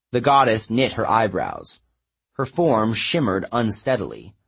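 usual speaking voice

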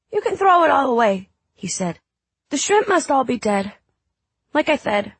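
put-on high small boy voice; a sick little boy; young boy voice. Child Drew voiced high.

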